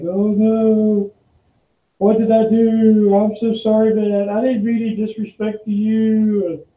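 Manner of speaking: high panicked pleading; freaked-out apology. Retold or imitated. Mimicking the panicked employee apologizing to the boss. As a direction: imitate him falling over himself to apologize. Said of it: "he just absolutely freaks out".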